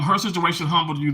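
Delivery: usual speaking voice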